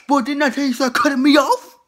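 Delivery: loud scolding grandpa; indignant grandpa shout. Acted. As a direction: snap back at the kid. Grandpa snaps back.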